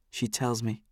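ordinary delivery, nothing marked

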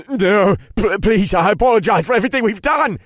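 high, loud, panicked stammer; terrified stutter. A narrator's reading. Warlock begging the narrator for his life, terrified stutter. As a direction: plead like a terrified child, voice shooting up. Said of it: "Jonas stuttered like a terrified child".

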